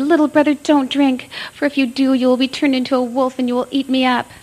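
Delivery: high, loud urgent warning; frightened plea. A narrator's reading. Little sister warns brother about the wolf spring, high and pleading. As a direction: beg him not to drink. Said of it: "little sister said".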